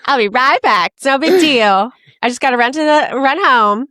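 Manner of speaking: high, loud carefree chirp; breezy boss. Retold or imitated. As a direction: brush it off like no big deal. S0 voices JFK Jr running home.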